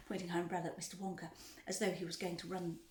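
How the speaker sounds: ordinary delivery, nothing marked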